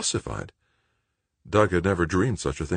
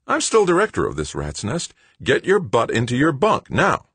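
bossy order; 10 semitones higher, 5 dB louder than usual. A narrator's reading. Director orders him to bed.

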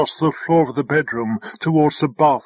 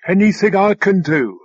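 raised, eager offer of help; pitched-up, lightly voiced drone question; helpful drone; 5 semitones higher, 4 dB louder than usual. A narrator's reading. Drone to Alva running past, lightly voiced offer.

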